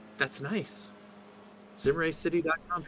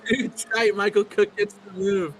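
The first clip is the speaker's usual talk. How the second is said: high-pitched, rising live commentary; excited race call; 9 semitones higher, 6 dB louder than usual. Real, not acted. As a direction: call the near miss as it happens, voice jumping up high. Live call of a tight overtake.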